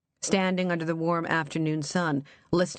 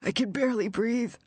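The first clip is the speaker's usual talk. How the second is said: higher, strained voice, barely getting air; breathless croak; 6 semitones higher than usual. Acted. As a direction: gasp it out from a buried coffin. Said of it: "Walter Smith croaked".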